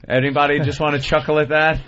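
usual speaking voice

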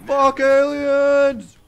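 loud, high, drawn-out scream; long shrieked battle cry; screaming man. Acted. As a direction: scream it like a crazed gunman. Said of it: "the man is screaming something".

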